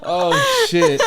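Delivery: high, helpless laughter through the words; can barely speak for laughing; laughing hard. Real, not acted. Cracking up at partner's fake Irish accent, laughing hard. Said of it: "you're literally crying 'cause of that".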